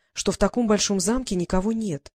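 usual speaking voice

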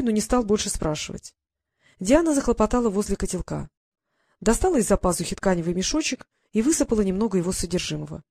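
usual speaking voice

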